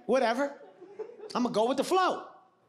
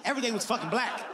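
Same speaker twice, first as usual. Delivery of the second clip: usual speaking voice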